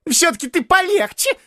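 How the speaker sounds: very high, whimpering plea; tearful voice. A narrator's reading. Neighbour pleading for a gentler beating, tearful. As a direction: whine it high, near tears. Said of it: "проговорил плачущим голосом Почечуев".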